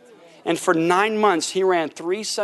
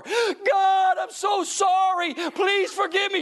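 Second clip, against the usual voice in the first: high, slowed shouting plea; screaming apology; 11 semitones higher, slower than usual. Retold or imitated. Worship leader begs God aloud, screaming apology. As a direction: cry out begging forgiveness, higher. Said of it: "She starts screaming at the top of her lungs".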